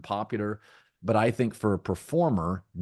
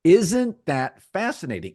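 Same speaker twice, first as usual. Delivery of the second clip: excited; 9 semitones higher, 7 dB louder than usual. Real, not acted. Pressing his point.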